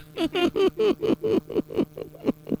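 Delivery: high chattering machine laugh; funny-voice noise. Shown as a demonstration. Showing what the funny voice does, high stuttering noise. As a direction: demonstrate a squeaky staccato effect. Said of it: "the funny, the funny voice".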